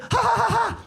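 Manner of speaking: high staccato "ha ha" laugh; gloating fake laugh, high-pitched; hammed-up mocking cackle. Gloating at second crash.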